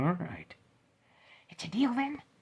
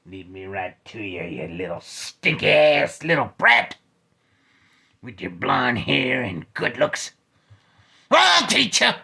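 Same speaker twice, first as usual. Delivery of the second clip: snarling threat; 3 semitones lower, 12 dB louder than usual. Acted. Villain threatening kid.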